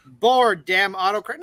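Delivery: ordinary talk, nothing marked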